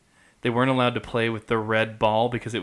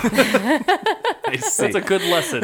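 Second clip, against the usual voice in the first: said through a high laugh; laughing while joking, slow; 12 semitones higher, 5 dB louder than usual. Real, not acted. Laughing at own joke.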